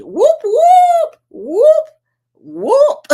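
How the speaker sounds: high, very loud party whoops; whooping cheer. Real, not acted. Host cheering a viewer's weight loss, loud high whoops.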